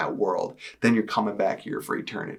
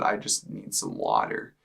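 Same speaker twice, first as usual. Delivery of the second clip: low, slow retold croak; dry-throated croak; 11 semitones lower, 4 dB quieter than usual. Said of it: "just croaking out one thing".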